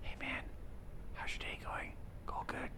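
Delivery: acted dorm whisper. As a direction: whisper casual small talk. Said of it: "we would just be like".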